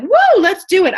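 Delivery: high, loud burst of eagerness; pumped up. Retold or imitated. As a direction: jump right in, all in. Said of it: "I'm like".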